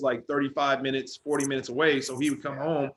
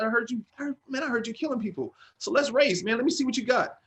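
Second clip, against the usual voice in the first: higher, cocky dare to race; cocky race challenge; 10 semitones higher than usual. Retold or imitated. Younger brother daring his big brother, cocky challenge. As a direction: talk big and push him to race. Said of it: "I said, I said".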